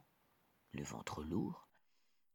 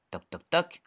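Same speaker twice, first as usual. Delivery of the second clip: knocking sound; 8 semitones higher, 5 dB louder than usual. Acted. Wolf knocks at door.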